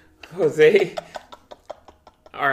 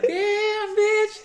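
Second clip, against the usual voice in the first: much higher and louder squealing "Damn, bitch"; 9 dB louder than usual. Retold or imitated. Repeating the friend's joke louder, a high loud squeal. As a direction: belt it high and loud for the laugh. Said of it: "He's like".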